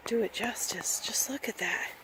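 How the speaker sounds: hushed, awed whisper. Real, not acted. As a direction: breathe it out in awe.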